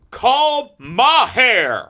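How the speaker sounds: high, slow, drawn-out yell; yelling dad. Retold or imitated. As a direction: shout the command at the phone. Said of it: "my dad would be in the front being like".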